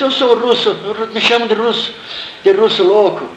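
a scared kid pleading through tears, mock-replayed; boy caught under the wall, answering the soldier in fright; retold crying boy. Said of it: "E eu chorando".

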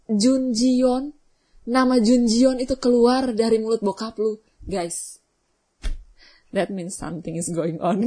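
usual speaking voice

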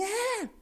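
very high drawn-out wail; mock wail. Victim as neighbours come out, imitated high wail. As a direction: imitate your own squealing, high and sustained.

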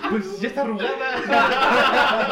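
laughing joke. Real, not acted. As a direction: say it while cracking up. Friend jokes, laughing.

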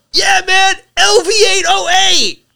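high, slow, drawn-out dude voice; mock the clueless kid yelling it out; imitating the kid.

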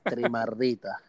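low, drawn-out maracucho "Trimardita"; hammed-up Zulian drawl on "Trimardita"; thick maracucho accent. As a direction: lay the Maracaibo accent on "Trimardita" to charm her. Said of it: "me encanta tu acento venezolano".

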